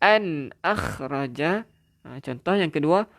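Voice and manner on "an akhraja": An akhraja slow with a clear nun; slow demo of An akhraja. Shown as a demonstration. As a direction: recite An akhraja slowly and clearly. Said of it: "jelaskan untuk izhar halqi".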